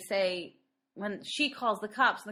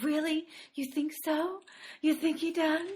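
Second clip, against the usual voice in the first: higher, eager, needy little voice; sniveling butler; 7 semitones higher than usual. Retold or imitated. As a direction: beg for reassurance like a fawning servant. Imitating the sniveling butler's hopeful reply.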